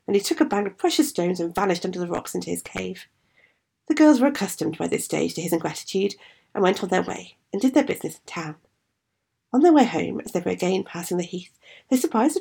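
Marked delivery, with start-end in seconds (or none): none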